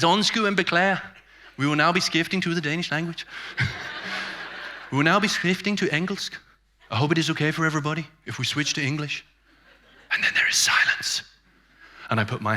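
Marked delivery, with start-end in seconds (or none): 0.0-9.2 put-on higher-pitched Danish-accented English; lightly mocked Danish host, politely upbeat; Danish presenter. Jens announces a language switch to the conference, Danish accent. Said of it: "Jens walks onto the stage with his big smile". 10.1-11.2 whispered for suspense; dramatic whisper. Acted. Comic telling of the room falling silent, whispered. Comic hush after the announcement.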